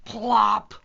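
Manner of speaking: popped, punchy "plop"; loud plop sound effect. A narrator's reading. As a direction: land a big loud plop. Narrated falling sound effect.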